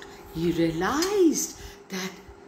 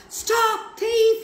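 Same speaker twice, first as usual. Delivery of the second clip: high, loud cry of stop thief; yelled; 6 dB louder than usual. A narrator's reading. Gentleman yelling after the fleeing boy, loud high cry. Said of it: "he yelled at Oliver".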